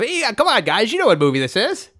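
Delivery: high, urging mock plea; imitating a friend getting desperate; insistent friend. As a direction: coax them excitedly to guess. Friend urges them to guess.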